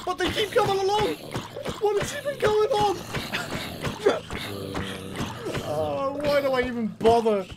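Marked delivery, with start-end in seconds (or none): 3.0-5.7 low laugh. Real, not acted. Zombies keep coming.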